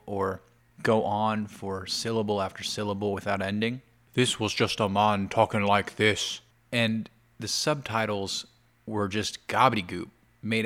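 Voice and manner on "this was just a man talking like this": imitated Scottish accent. Vlogger shows how the man in the video talked, mock Scottish accent. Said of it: "speaking in a Scottish accent".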